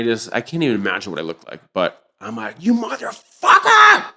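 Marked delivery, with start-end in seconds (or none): 2.6-4.0 high, drawn-out furious yell; slow, high roar of rage at the door; furious shout. Retold or imitated. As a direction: scream it at the man who robbed you. Confronting the adviser at his door.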